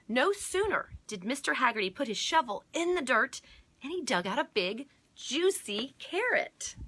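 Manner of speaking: high, loud read-aloud, stretching the last words; animated picture-book reading, key words punched; excited story narration. A narrator's reading. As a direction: build up to a big reveal for children. Reads the story's big moment.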